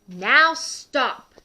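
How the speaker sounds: high, loud order; commanding shout. A narrator's reading. Max halts the wild rumpus, commanding shout. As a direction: call an abrupt halt over the noise.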